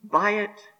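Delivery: ordinary delivery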